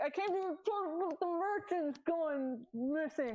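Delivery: high, slow, muffled protest; muffled by a rag. Acted. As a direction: talk through a rag rubbing your face. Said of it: "I can't hear you".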